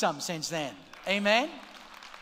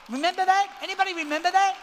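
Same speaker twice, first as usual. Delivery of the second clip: excited callout; 8 semitones higher, 5 dB louder, faster than usual. Real, not acted. Calls out to congregation.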